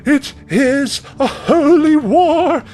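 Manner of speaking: very high, slow, loud war cry; fervent villager cry. Acted. A villager crying out while hurling debris at the angel, high shrieking proclamation. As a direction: proclaim it like a zealot charging in. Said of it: "another voice going".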